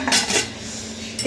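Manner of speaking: breathy, voiceless chuckle; soft laugh. Real, not acted. Vlogger amused about her small box, soft laugh. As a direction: let out a little airy laugh.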